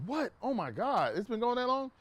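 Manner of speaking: high, astonished disbelief; mock gasp at how long it's been; retold shock. Shocked at how long car was gone.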